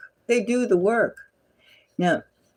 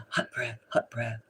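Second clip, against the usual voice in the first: mock hot breath; 7 semitones lower, 10 dB quieter than usual. Mimicking sultry breathing.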